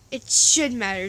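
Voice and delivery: loud, emphatic acted protest; shouted insistence. A narrator's reading. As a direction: raise the voice and punch SHOULD. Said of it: "Jack shouted".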